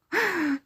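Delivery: high, falling giggle; airy, charmed giggle; fond little laugh. Acted. Delighted by the listener's blushing.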